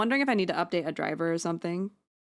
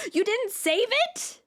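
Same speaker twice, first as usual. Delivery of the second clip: much higher, louder, slow shocked outcry; outraged disbelief; 13 semitones higher, 3 dB louder, slower than usual. Real, not acted. Streamer learning the iconic stream is gone, shocked outcry. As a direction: cry out in real disbelief.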